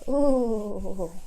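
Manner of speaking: playful brr-style ooh; mock shivering ooh. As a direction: pretend cold shiver.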